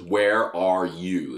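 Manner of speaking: slow, over-the-top American accent; exaggerated American vowels, drawn right out. Shown as a demonstration. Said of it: "all fucking over the top".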